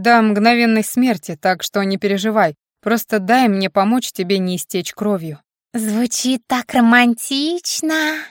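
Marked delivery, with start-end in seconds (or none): 5.7-8.2 slow squeaky fairy voice; a little slow fairy squeak; pixie. A narrator's reading. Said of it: "пропищала фея". (медленный писклявый голосок феи)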